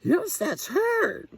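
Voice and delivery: very high, slow overjoyed owlet; bursting with excitement; excited cry. A narrator's reading. Little Owl hearing her mother's call, high and excited. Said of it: "cried Little Owl in excitement".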